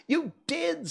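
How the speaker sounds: very high insistent retort; high-pitched accusation; quarrelling Hatter. A narrator's reading. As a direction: contradict him in a squeaky high voice. Hatter and March Hare quarrel.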